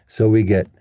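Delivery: ordinary talk, nothing marked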